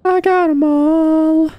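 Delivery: high, slow triumphant cheer; excited. Real, not acted. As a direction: cheer it out, stretched long. Completes the whole map.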